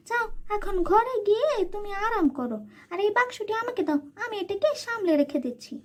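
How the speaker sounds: high-pitched sweet-talking aunt; high, sly aunt welcoming her niece. Acted. Aunt talks her into handing box.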